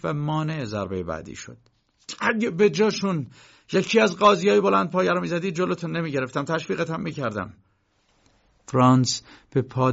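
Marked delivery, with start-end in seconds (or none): 2.2-7.5 raised, higher defiant voice; heated, louder challenge to the whipper; defiant outburst. Acted. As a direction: stand up to him and let it ring. K grabs the whip, defiant.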